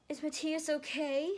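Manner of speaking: put-on high, slow anxious boy voice; a young boy asking, worried; worried teen voice. Teen Matthew worried about his friend, high and slow.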